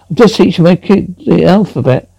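normal talking voice

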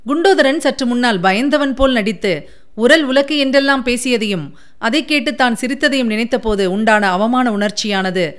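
usual speaking voice

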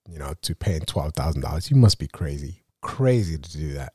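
ordinary talk

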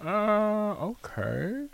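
high, hesitant mock acceptance; retold puzzlement. Retells puzzled reaction to senior.

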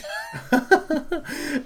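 high-pitched burst of laughter. Real, not acted. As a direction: crack up high. Laughing hard.

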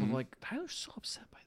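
soft, whispery and confiding; hushed aside. Real, not acted. Podcaster confiding how upset a film character is, hushed. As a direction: drop to a near whisper.

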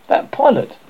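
high, loud "That pilot!"; sudden realization. Acted. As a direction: it suddenly hits him. Fat boy suddenly remembers the pilot.

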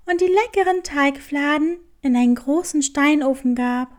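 plain reading voice

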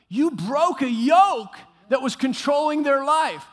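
loud, slow, emphatic shout. Acted. Pastor shouting back at a doubtful church member, slow and loud. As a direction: bellow it to convince them.

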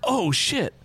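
like it just dawned on you; waking on the plane, realising what happened; sudden realisation. Retold or imitated.

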